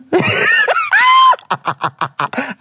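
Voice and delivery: real high cackle; shrieking laugh. Real, not acted. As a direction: let out a screaming laugh. Hosts laughing hard.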